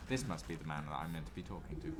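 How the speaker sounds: normal talking voice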